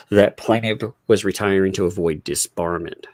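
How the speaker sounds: ordinary delivery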